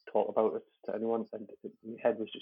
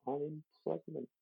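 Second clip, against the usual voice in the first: higher, softer, drawn-out voice; imitated partner; 5 semitones higher, 9 dB quieter, much slower than usual. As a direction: imitate her breaking news softly and slowly. Retold partner breaking pregnancy news.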